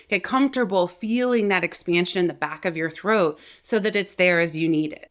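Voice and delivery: usual speaking voice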